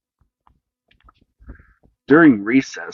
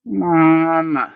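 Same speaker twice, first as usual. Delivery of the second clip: groaning; 6 semitones higher than usual. A narrator's reading. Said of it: "groaned Sammy".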